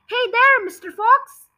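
high, loud taunting call; yelled. Acted. Farmer leaning out of his tractor taunting the fox, loud yell. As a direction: yell down to the fox to mock him. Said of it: "yelled Bounce".

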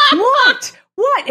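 high, loud imitation of a shocked man; mock shock. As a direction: squawk it in hammed-up disbelief. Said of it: "like, shocked that she has made him stop".

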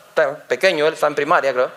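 ordinary delivery

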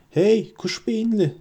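high, slow taunting yell; shouting. A narrator's reading. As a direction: shout to draw the troll's attention. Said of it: "diye bağırarak".

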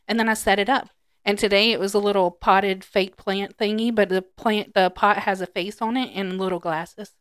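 ordinary delivery

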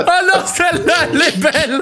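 high, talking through laughter; laughing. Real, not acted. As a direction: gush while still cracking up. Still laughing at the joke.

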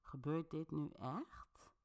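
slow, hushed disbelief; in shock. A narrator's reading. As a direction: say it softly and slowly, stunned. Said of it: "maar meer in shock".

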